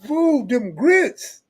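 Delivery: high, slow mock Southern drawl of Cartoon; imitating Cartoon. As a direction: ham up a country storyteller. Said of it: "He like".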